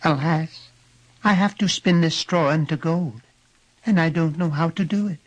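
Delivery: put-on high-pitched lamenting girl; distressed girl. A narrator's reading. Girl explains her task to little man, distressed.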